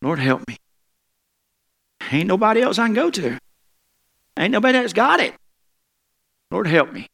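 high, pleading cry to God; desperate plea. Shown as a demonstration. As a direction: beg as if nobody else can help. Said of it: "Somebody say".

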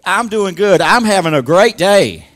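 loud, ringing declaration; emphatic. Retold or imitated. Preacher repeating a church lady's testimony, loudly emphatic. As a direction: belt out GOOD and GREAT DAY. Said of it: "she emphasized that".

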